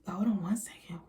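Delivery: whispered, no voice at all. Real, not acted. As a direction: breathe it out as a whisper.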